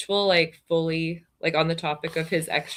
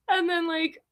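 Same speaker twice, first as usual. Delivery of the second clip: usual speaking voice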